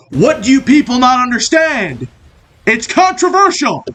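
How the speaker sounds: high-pitched exasperated rant; mock heated rant. Comedy host ranting at his viewers, exasperated and high-pitched. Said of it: "I was getting a little heated".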